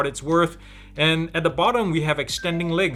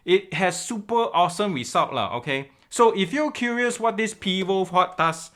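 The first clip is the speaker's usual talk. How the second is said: Singlish-flavoured Malaysian English, pitched higher; Malaysian Uncle Roger accent; 3 semitones higher than usual. Acted. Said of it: "do the intro in that accent".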